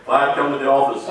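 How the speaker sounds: loud summons over the intercom; intercom call. Retold or imitated. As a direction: call him to the office, loud. Intercom calling him again.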